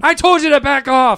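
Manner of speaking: high loud warning bark; mock angry yell. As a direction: yell it like a fed-up guard. Retold yelling, repeated warning.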